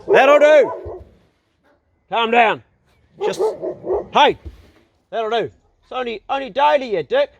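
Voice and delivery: high, loud shout at the dog; yelling at dog. Real, not acted. Man breaks off to yell at his dog. Telling off dog mid video.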